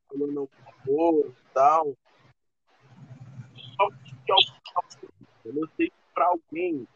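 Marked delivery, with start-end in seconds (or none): 3.5-6.9 audio cutting out; choppy broken connection; glitching audio. Real, not acted. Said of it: "teu áudio tá cortando muito".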